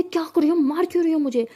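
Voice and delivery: loud, high outraged cry; shocked angry protest. A narrator's reading. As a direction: protest being slapped. Slapped girl protesting loudly. (चौंककर गुस्से से चिल्लाते हुए)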